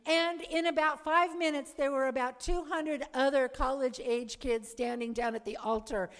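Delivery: ordinary delivery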